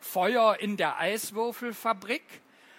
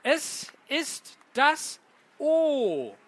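the O drawn out slowly, higher, falling; slow reveal; 6 semitones higher, 4 dB louder, much slower than usual. Shown as a demonstration. Poet slowly revealing the riddle answer to children. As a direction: stretch out the O like a grand reveal.

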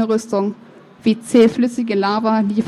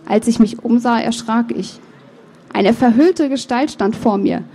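ordinary delivery

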